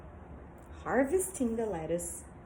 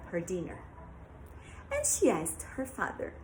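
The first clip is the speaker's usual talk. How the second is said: plain reading voice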